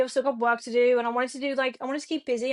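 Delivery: ordinary talk, nothing marked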